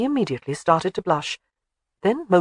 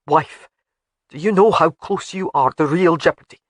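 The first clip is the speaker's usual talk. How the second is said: threatening husband; 5 dB louder than usual. A narrator's reading. Alec threatening.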